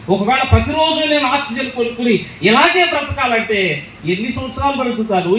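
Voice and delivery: usual speaking voice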